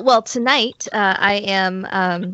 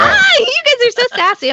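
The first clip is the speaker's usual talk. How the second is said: mock sassy squeal; 4 dB louder than usual. Mock sassy greeting to hosts.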